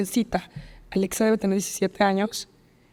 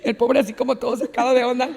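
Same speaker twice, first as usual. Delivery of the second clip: usual speaking voice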